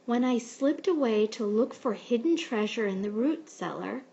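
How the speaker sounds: usual speaking voice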